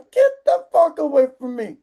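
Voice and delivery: comic angry outburst; indignant dismissal. Acted. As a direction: shoo them away in exasperation. Indignant dismissal ending the rant.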